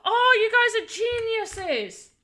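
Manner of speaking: high, loud, drawn-out delight; delighted praise. Real, not acted. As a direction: gush loudly with drawn-out words. Delighted at chat's help.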